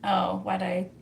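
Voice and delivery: ordinary talk, nothing marked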